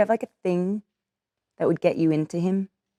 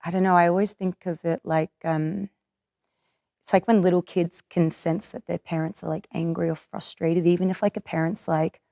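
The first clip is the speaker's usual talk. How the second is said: ordinary delivery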